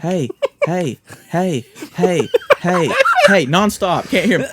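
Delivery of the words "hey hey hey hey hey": higher, repeated "hey" that never projects; mock feeble hailing, evenly repeated; weak-voiced calling imitation. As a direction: imitate calling out without raising the voice. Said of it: "he can't raise his voice any".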